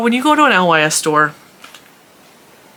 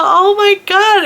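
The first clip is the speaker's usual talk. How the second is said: much higher, louder and slow, overwhelmed fan; starstruck squeal; 6 dB louder, slower than usual. Retold or imitated. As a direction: stretch it out, giddy with excitement. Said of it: "And she's so excited".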